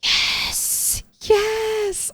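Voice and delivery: sudden high cheer; excited yes. Real, not acted. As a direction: squeal with delight. Excited at found loot.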